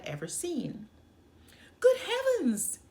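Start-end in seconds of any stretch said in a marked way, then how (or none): 1.8-2.8 high, loud astonished gasp; gasping in shock. Acted. Young princess seeing the frog become a man, high shocked gasp. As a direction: cry out at the sudden transformation. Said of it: "the young princess gasped".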